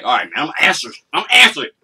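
rising into a fed-up yell; building exasperated shout. Retold or imitated. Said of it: "it's like".

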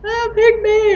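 put-on high, pleading little-kid voice; eager kid. Unpicked kid in Pictionary begging friends, high and eager. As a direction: beg to be picked. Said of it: "I'm like".

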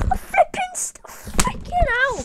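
very high, loud, drawn-out despairing cry; anguished yell. Real, not acted. Player reacting to his lander crashing, drawn-out anguished yell. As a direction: wail it slowly as everything crashes.

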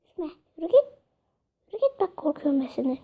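ordinary talk

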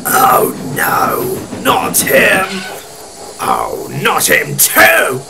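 rough, exasperated growl. Acted. Alfred seeing Wilfred pull in, growling. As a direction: growl in dismay at the newcomer. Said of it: "growled Alfred".

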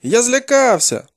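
high, drawn-out cry; frightened confession. A narrator's reading. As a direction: confess as a cornered boy, slow and high. Captive boy confesses in fear.

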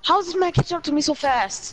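high alarmed question; startled, pitch jumping up; surprised. Real, not acted. Chased in game.

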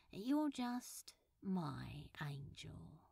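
slow, hushed and loving; a slow tender whispered endearment. Acted. Tender love confession.

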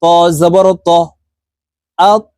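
slow, measured letter-by-letter recitation; spelling drill. Shown as a demonstration. Teacher spelling syllables for learners, slow measured drill. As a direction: spell it out, then blend the syllables.

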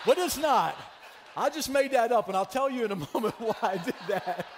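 loud, laughing admission; laughing confession. Real, not acted. Pastor to congregation admitting a fake story, laughing. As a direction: laugh through owning up to the made-up story.

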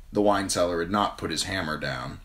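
ordinary delivery

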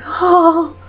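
high, drawn-out dreamy "oh"; swooning oh. Real, not acted. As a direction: swoon with a high sigh of longing. Swooning over a dreamed-of accent.

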